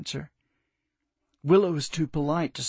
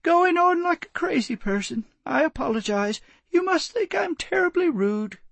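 much higher, embarrassed apology; flustered old lady. Acted. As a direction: brush off her own tears briskly. Willow composes herself, embarrassed.

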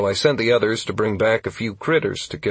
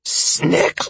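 coughing; 8 semitones higher, 3 dB louder than usual. A narrator's reading.

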